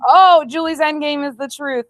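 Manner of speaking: sarcastic high echo of Julie; mocking. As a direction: parrot her line back in mockery.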